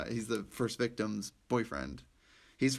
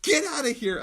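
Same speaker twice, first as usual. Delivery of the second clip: incredulous chief; 5 dB louder than usual. Retold or imitated. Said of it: "He's like".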